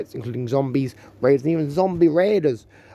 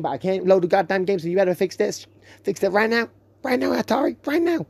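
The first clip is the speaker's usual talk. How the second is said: rising higher, ever more insistent; ranting; 4 semitones higher than usual. Real, not acted. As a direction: build the demand up higher each time. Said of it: "little rant".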